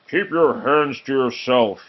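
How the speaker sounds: a touch of ogre growl; mild growl. Acted. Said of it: "he growled at his companion".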